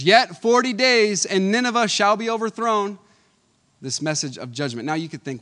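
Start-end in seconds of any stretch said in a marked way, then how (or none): 0.0-3.0 solemn proclamation. Acted. Serious message proclaimed.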